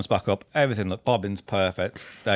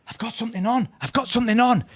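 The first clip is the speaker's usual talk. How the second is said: higher, keen announcement; excited call; 11 semitones higher than usual. Retold or imitated. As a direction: tell your mate you've hooked something. Angler hooking something.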